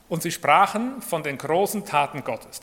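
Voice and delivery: ordinary talk, nothing marked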